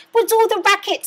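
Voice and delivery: high, loud startled cry; Cindy cries out from the kitchen. A narrator's reading. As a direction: call it round the door. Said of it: "Cindy cried".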